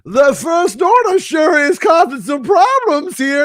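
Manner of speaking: loud, high hammed-up character accent; theme park role player. Park actor accosting a tired parent, loud hammy accent. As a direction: boom it out high and slow, overacting. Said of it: "four accents during the course of it".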